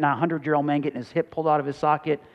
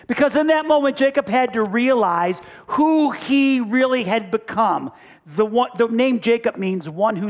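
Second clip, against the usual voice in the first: higher and louder, driving the point home; full-force preaching; 7 semitones higher, 5 dB louder than usual. Acted. Pastor pressing the point to his church, preaching hard. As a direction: preach it to the congregation.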